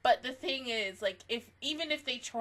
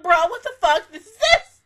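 higher, louder disbelieving yell; retold outburst; 10 semitones higher, 16 dB louder than usual. Fan retelling the game's ending to viewers, disbelieving yell. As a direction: yell it like you can't believe the ending. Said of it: "and you're like".

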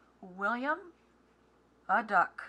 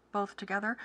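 usual speaking voice

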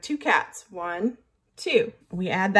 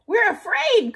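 much higher, frightened cry; scared fish; 12 semitones higher, 4 dB louder than usual. A narrator's reading. Fish tell the group at nightfall, much higher frightened cry. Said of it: "cried the fish".

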